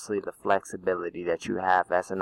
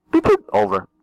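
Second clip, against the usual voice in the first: much higher squeaky radio beep; 7 dB louder than usual. Retold or imitated. Radio beep to end joke.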